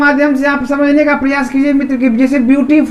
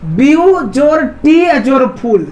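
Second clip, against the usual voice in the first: View, टी, फुल slow and separate, joined by जोर; syllable by syllable; slower than usual. Shown as a demonstration. Teacher adding up three syllables, syllable by syllable. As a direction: spell out the sum ब्यू जोर टी जोर फुल slowly. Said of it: "कितना हिस्सा बंट रहा है".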